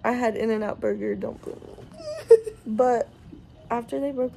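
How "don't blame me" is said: much higher, slow whiny plea; playful plea. Real, not acted. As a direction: whine it, teasing.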